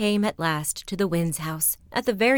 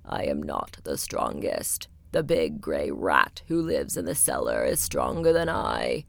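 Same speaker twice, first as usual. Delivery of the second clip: very low slow wall voice; grumbling wall. Acted. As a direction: grumble slowly like crumbling stone. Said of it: "grumbled the wall".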